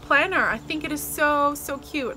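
ordinary delivery

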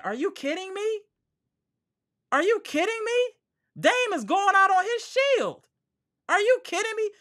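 high-pitched, squealing disbelief; incredulous. Real, not acted. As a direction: protest in disbelief, pitch shot way up. Incredulous defense of criticised player.

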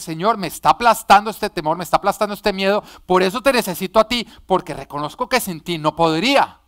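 loud, impassioned preaching that keeps building; fervent preaching. Acted. Preacher urging the congregation to confess their fear, shouting fervently. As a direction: pour the whole soul into it, loud.

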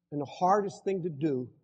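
ordinary talk

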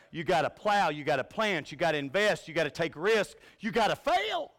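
each line punched harder, peaking loud and high on fail; building list. Acted. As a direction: climb the list to the last word. Preacher listing steps, climbing.

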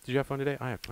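"did you have fun today" asked flat and deflated, like a losing host; deflated game show host. Real, not acted. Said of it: "Do I sound like a game show host that you lost".

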